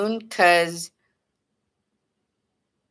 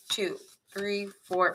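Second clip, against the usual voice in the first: normal talking voice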